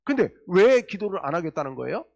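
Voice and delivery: ordinary delivery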